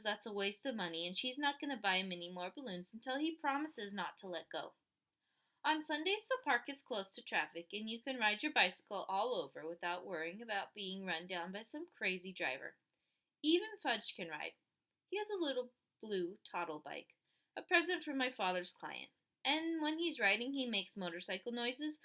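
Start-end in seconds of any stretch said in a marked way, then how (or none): none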